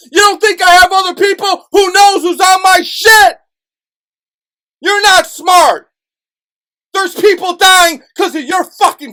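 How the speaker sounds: shouting at viewers in a real rage; furious screaming. Real, not acted. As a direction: yell at them in real fury. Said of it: "why I'm acting like a crazy person, screaming".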